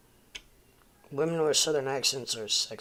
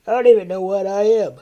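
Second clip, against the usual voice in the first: loud, high-pitched cry of confusion; sudden raised-voice protest; exasperated outburst; 7 semitones higher, 16 dB louder than usual. Real, not acted. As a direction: burst out loudly, baffled at yourself. Mock exasperation over his region.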